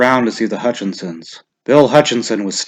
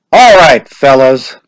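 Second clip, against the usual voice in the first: louder, higher call; announcing to the crowd; 3 semitones higher, 10 dB louder, slower than usual. A narrator's reading. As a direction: call the men to open their slips. Official announcing.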